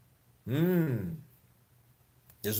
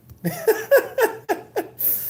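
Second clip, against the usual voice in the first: high laugh; 4 dB louder than usual. Real, not acted.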